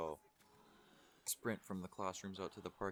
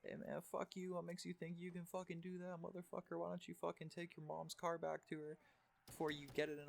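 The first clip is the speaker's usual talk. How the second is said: mock yell back; 11 semitones higher than usual. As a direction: squeal out a hammed-up comeback. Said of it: "I yelled back".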